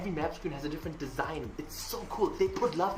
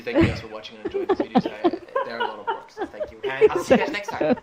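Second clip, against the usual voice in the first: higher, louder and fast, laughing through the thanks; gushing outro thanks; excited sign-off; 11 semitones higher, 7 dB louder, faster than usual. Real, not acted. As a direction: rush the goodbye with giddy energy.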